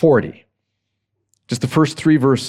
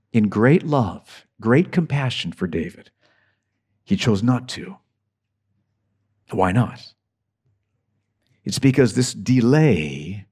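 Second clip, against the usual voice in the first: tender; 6 semitones lower, 3 dB quieter than usual. Real, not acted. Tender point about God's love.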